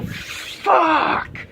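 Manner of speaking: high, hissed mock outburst; Lars yelling. Lars in his bandmate's face, hissed mock yell. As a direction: spit it out like a brat. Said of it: "get right in their face and go".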